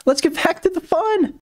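high-pitched singsong announcement; playful segue. Real, not acted. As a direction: lift it high, back to the show. Leaving sponsor read for the story.

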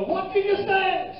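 put-on a woken boyfriend jolting up in shock; startled boyfriend. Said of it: "They're gonna go".

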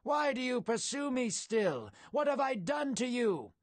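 restrained storyteller's bellow across the camp; bellowed appeal. A narrator's reading. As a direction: call out a plea for all to hear. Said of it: "David's words bellowed for all".

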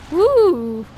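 loud, high whoop; excited woo. Real, not acted. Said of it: "I'll stop making that sound".

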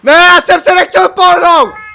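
high, very loud, drawn-out protest; anguished scream. Real, not acted. Player moved by accident and loses, screaming in protest. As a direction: scream it in disbelief, stretching the words.

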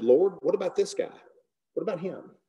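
high, insistent whining question; pleading Peter. Retold or imitated. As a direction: Peter pushing, voice rising on each repeat. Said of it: "he turned to Jesus and said".

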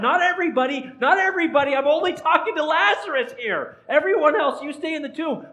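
playfully urgent crowd control; hasty clarification. Acted. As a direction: hurriedly wave off everyone else. Acts Jesus limiting his command.

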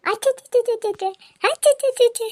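very high rapid-fire babble; baby-amusing noises. Acted. Making a baby laugh with high, playful babble. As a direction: chatter high nonsense syllables at a baby.

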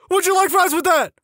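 very high mock shout; recruit's shout. Screw-up shouting during push-ups, high mock shout. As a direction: call it out high like a humiliated trainee. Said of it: "is told to shout".

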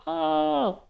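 high-pitched scream, held back in volume; storyteller's imitation of a scared yell; mock frightened shout. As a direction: cry "Ah!" high, without full force. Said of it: "He shouted out".

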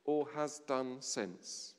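ordinary talk

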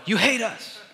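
put-on high, whining child's complaint; whiny kid. Kid at Disneyland whining to the parent, high and whiny. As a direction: pout it out like a sulking kid.

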